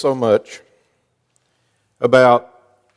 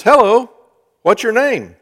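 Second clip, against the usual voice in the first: eager solicitor; 7 semitones higher, 5 dB louder than usual. Retold or imitated. Solicitor on phone with child.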